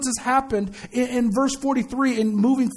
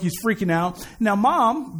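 usual speaking voice

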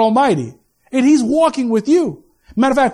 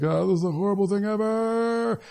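soft groaning complaint, last word stretched out; mock agonised moan; 2 semitones lower, 8 dB quieter than usual. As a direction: imitate a man moaning in pain. Imitates men after vasectomy, moaning.

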